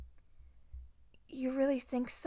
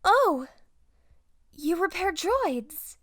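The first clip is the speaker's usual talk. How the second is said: a bright, high "Oh!" bursting out loud; light up at the discovery; delighted surprise; 5 semitones higher, 8 dB louder, slower than usual. Acted. Padawan to her rescuer, delighted surprise at his droid work. Padawan learns the host's trade.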